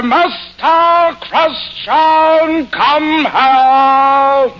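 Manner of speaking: high, slow, roaring captain's bark; Charles Laughton. Retold or imitated. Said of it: "They don't put enough feeling into it. They should say".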